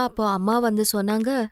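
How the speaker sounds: plain reading voice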